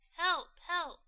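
high, loud cry for help; harp cries help. Acted. The magic harp calling for help as it is stolen, high and loud. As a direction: shout it high, the harp in danger. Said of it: "says the harp".

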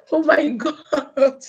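real laughing exclamation. Real, not acted. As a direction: laugh the words out slowly. Laughing on live stream.

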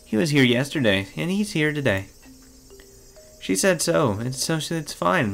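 put-on high, eerily dismissive girl. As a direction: brush it off with a creepy calm. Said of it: "speaking unbelievably dismissively".

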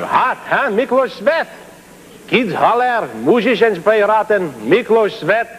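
worked-up grumble. Acted. Griping about the hot noisy studio.

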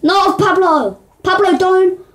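high, loud panicked calls; panicked yelling. Real, not acted. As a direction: yell in alarm at a teammate. Panicked shouting at teammate.

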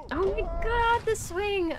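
high, loud sudden gasp of surprise; startled exclamation. Real, not acted. Reacting to the swing mid-stream, startled.

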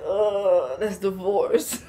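slow, drawn-out mock disgust; mock put-off. Vlogger reacting to negative energy, mock put-off. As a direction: groan the "Oh" as if turned off. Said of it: "Negative energy, I'm like".

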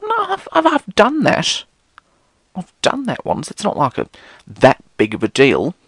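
lightly mocking friend's casual reply; dismissive friend. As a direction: shrug it off in a high silly voice.

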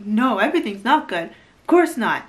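mock-exasperated denial, pushed up in pitch; upset retort. Patient snapping back at her partner's question, upset. Acts Ariel's upset answer to Binyam.

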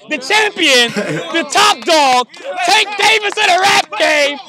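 host presents the champion to the crowd, hyped announcer call; hype announcer. Pretend. Build it up like a ring announcer.

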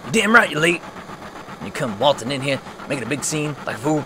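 loud, gruff chewing-out; shouted reprimand; angry Barret scolding. Acted. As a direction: tear into the late arrival. Barret scolding a late Cloud.

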